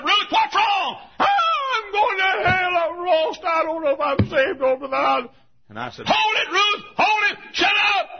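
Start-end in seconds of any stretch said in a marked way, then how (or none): 0.0-1.0 high, loud alarmed call; urgent shout. Retold or imitated. Preacher to screaming Ruth: urgent high shout. 1.2-5.3 very high, loud terrified cry; hysterical wailing. Retold or imitated. Ruth on the floor to preacher: hysterical high wail. 6.1-8.1 high, loud sharp order; commanding shout. Retold or imitated. Preacher to hysterical Ruth: loud high command.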